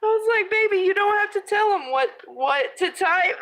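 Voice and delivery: high, laughing, slowing at the end. Real, not acted. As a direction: giggle through the retold line. Laughing while retelling to son.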